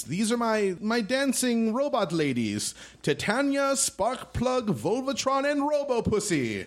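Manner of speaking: very high showman voice, slow; proud announcer. Acted. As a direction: present the dancers with flourish. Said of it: "He announces".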